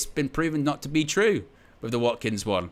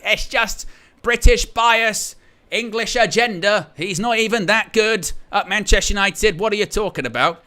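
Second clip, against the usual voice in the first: mocking the critics; 8 semitones higher, 6 dB louder than usual. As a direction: whine it out like an indignant fan mocking him. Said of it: "you get the, the natural pushback".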